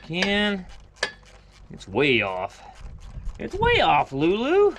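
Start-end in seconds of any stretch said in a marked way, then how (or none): 3.4-4.8 much higher, louder sing-song pet talk; doting high voice for the dog. Real, not acted. Talking to his dog Lulu.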